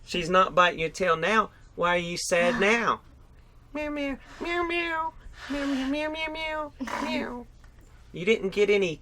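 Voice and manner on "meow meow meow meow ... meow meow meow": high, squeaky play-cat meows; dad playing Grumpy Cat with high mewing. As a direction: meow up high like a little cat for the kids. Dad voices Grumpy Cat mask.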